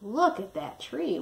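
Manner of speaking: usual speaking voice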